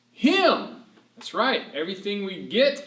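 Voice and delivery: ordinary talk, nothing marked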